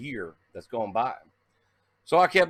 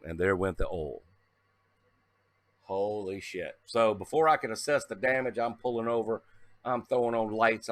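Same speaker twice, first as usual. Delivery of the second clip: ordinary talk, nothing marked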